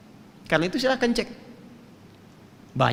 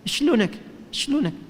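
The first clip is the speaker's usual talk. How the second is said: higher, lilting Syrian Arabic greeting; Syrian dialect; 8 semitones higher than usual. Retold or imitated. Teacher showing students the Syrian greeting. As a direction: greet like a Damascus local. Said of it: "Orang Syria bilang apa?".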